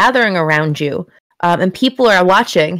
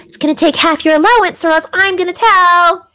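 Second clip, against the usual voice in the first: put-on higher, teasing child voice; bratty little sister; 9 semitones higher than usual. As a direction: threaten to tell, sing-song.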